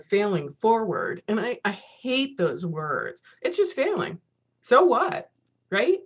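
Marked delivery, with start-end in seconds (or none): 3.4-6.0 scoffing. Real, not acted. Rejecting the phrase failing forward.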